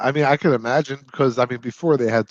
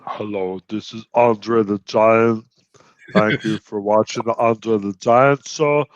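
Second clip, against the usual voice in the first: Andre the Giant voice. Pretend. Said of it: "You sound like Arnold Schwarzenegger trying to do Andre the Giant".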